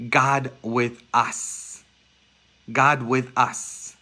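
God with us, drawn out slowly; slow emphatic repetition. Real, not acted. Preacher to congregation, slow and solemn. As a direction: let each word land slowly.

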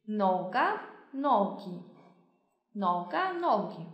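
noga then nogi, said slowly, endings a and i clear; slow contrast drill. Shown as a demonstration. Teacher contrasting singular noga with plural nogi slowly. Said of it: "那我们看一下，noga，nogi".

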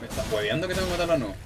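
usual speaking voice

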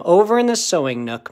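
ordinary delivery

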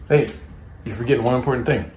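ordinary delivery

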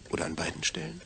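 ordinary talk

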